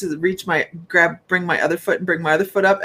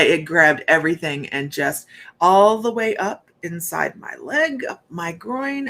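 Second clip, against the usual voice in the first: normal talking voice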